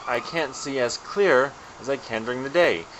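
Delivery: normal talking voice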